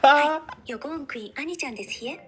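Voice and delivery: put-on cutesy Japanese anime girl; Japanese-accented cute anime character voice. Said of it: "Anime girl Japanese accent".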